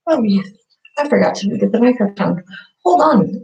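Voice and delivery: ordinary delivery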